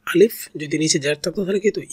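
ordinary delivery

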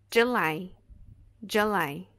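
July, LY stressed, j as dj; joo-LIE, second syllable strong; July stressed at the end. Shown as a demonstration. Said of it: "E em July".

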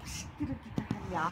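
breathy whispered swearing; whispered curse. Real, not acted. After a missed chance, cursing to himself: whispered swear. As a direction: hiss the curse under your breath.

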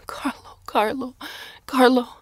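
high, loud cries of his name; calling out. A narrator's reading. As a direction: call out to a husband just home. Wife calling her returned husband.

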